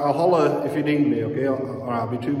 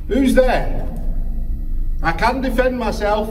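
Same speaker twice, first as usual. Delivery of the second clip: higher, louder bravado calls; calling into the dark; 11 semitones higher, 3 dB louder than usual. Acted. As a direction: shout brave threats into darkness.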